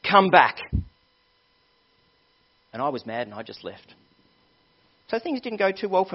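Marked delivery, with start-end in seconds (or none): 0.0-0.6 loud, high acted father's shout; angry yell. Daddy yelling at his son who is leaving, acted angry yell. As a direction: bellow it like a daddy laying down the law, easing slightly. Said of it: "my daddy yelled back at me".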